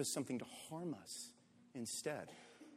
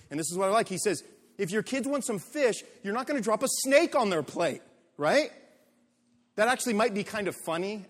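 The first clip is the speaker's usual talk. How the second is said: loud, fast, driving sermon delivery; punchy, loud pulpit voice; full-force preaching; 5 semitones higher, 5 dB louder than usual. Acted. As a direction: preach it hard to the congregation.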